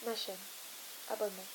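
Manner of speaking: ordinary delivery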